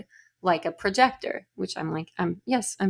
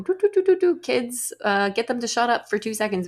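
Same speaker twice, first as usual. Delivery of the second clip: mocking singsong; 4 semitones higher than usual. Mocking teachers' lazy video.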